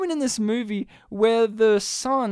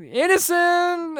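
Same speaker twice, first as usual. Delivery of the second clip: high, drawn-out cheer; mock proclamation; 9 semitones higher, slower than usual. Podcaster mimics police clearing the dad, mock triumphant. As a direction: announce it high and stretched, poking fun.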